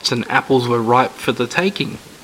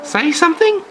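put-on old lady voice.

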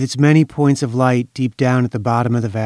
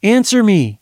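high, pleading call; 8 semitones higher, 3 dB louder than usual. A narrator's reading. Mother calling her silent daughter on the phone. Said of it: "she called".

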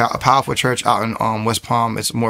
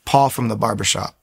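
usual speaking voice

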